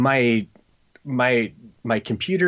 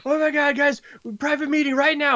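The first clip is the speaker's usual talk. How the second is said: freaking out. Retold or imitated. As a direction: imitate a frantic guy losing it at 3 am. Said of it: "he's, like, freaking out".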